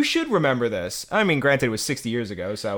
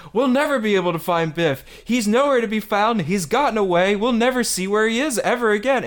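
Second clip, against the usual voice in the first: lightly acted, higher-pitched character quote; walkie-talkie report; 7 semitones higher than usual. Retelling a film's walkie-talkie scene, mock despairing report. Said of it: "he's on the walkie-talkie and he's like".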